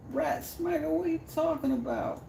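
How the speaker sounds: very high, squeaky put-on incredulity; mock disbelief. Streamer to viewer asking for mod status, mock disbelief. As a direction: play-act being baffled, way up in falsetto.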